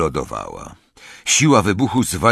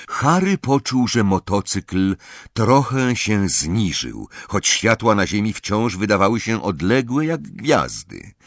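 usual speaking voice

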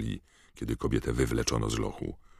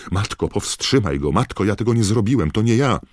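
high, loud, frantic pleading; panicked shouts of innocence; desperate cry; 9 semitones higher, 9 dB louder than usual. A narrator's reading. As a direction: beg mother to step in, growing more panicked. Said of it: "krzyknął młodzieniec".

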